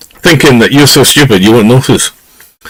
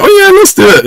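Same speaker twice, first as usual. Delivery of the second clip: high chirpy imitation of the friend; mock eager friend. Mocking scared friend who agrees eagerly, high. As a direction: squeak it like a naive keen pal.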